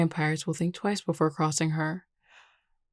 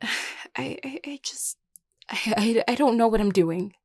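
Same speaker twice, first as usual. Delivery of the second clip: halting sigh, then higher outburst; distressed confession; 5 semitones higher than usual. Acted. Overwhelmed leader admitting doubt to a friend, distressed. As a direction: stammer from near-whisper into anguished admission.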